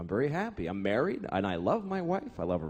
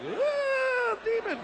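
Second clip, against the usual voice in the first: scared shriek; much slower than usual. Acted. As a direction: shriek at the demon in terror. Spots a demon, screams.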